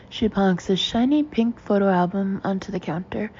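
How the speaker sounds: ordinary delivery, nothing marked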